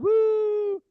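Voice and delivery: high whooping woo; loud woo cheer. Real, not acted. Host whooping to fire up the crowd. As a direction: let out a big high whoop.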